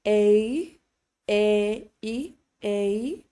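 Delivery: slowed A gliding into an E sound; A pulled apart into two vowels; drawn-out diphthong A. Shown as a demonstration. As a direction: stretch A so both vowels show. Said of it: "we're actually saying two vowels".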